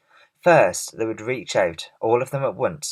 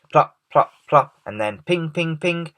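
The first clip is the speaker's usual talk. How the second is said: sound effects; 6 semitones higher, 3 dB louder than usual. A narrator's reading. Narrator voicing hail sounds.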